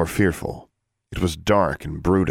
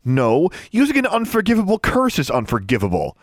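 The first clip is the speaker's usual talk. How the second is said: sharp, much higher snap; snapping; 11 semitones higher than usual. A narrator's reading. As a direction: bite back at him hard. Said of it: "Alexandra snapped".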